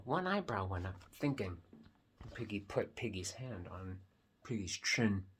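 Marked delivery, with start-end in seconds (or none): none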